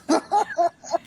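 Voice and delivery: high burst of chuckling; laugh. Real, not acted. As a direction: laugh at your own remark. Amused at own punched remark.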